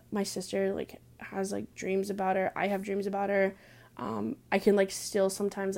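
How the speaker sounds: normal talking voice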